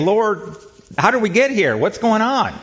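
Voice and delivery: high-pitched imitation of a desperate plea; mock cry of desperation. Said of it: "It's really a cry of, uh, of desperation".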